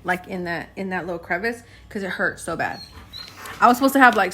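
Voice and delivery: ordinary talk, nothing marked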